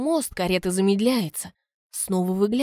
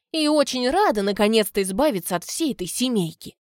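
ordinary delivery, nothing marked

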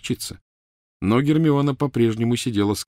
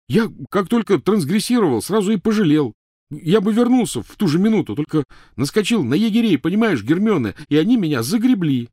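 high, loud, insistent confession; agitated, raised-voice excuse; urgent pleading; 11 semitones higher, 6 dB louder than usual. Acted. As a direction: plead at full volume to be heard. Ron pleading his case loudly.

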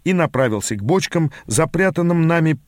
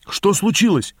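higher-pitched question, mildly raised voice; lightly raised, insistent question; 6 semitones higher than usual. A narrator's reading. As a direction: ask more urgently, pitch up. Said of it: "повысил голос я".

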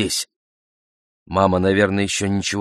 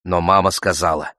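plain reading voice

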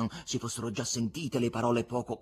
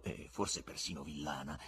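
ordinary delivery

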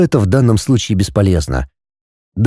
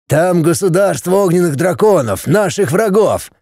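shouting over wind; 7 semitones higher than usual. A narrator's reading. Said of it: "проорал дракон в ответ".

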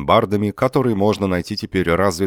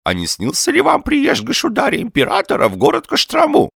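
high, loud lisping question; eager old man; 5 dB louder than usual. A narrator's reading. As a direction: ask with breathless hope in an old man's high voice. Old man asks eagerly about dreams.

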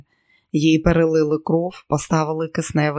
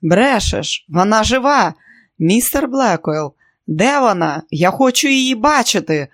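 loud, high desperate denial; frantic shouting; 5 semitones higher, 4 dB louder than usual. Acted. Husband refuses doctor's news.

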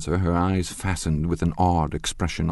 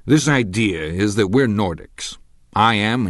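loud, higher lecturing bully; blustering Tom; 4 semitones higher, 6 dB louder than usual. Acted. Tom lecturing the dinner guests, loud and pompous. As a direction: hammer his half-read theory home.

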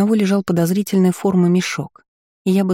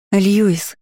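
anguished call; 4 semitones higher than usual. A narrator's reading. Heroine cries out to the boy in the sack.

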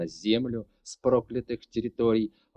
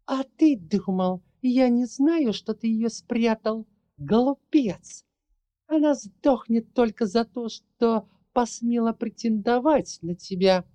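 gloating villainess; 12 semitones higher, 4 dB louder than usual. Acted. Villainess gloats over rival.

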